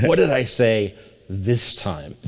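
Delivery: ordinary talk, nothing marked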